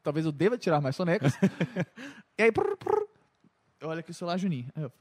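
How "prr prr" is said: high trilled phone-ring noise; high-pitched vocal imitation of a buzzing phone; imitated phone buzz. Imitates phone going off during nap.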